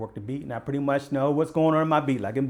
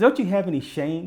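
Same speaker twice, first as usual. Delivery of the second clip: indignant; 7 semitones higher, 7 dB louder than usual. Retold or imitated. Officer scolding the kingpin.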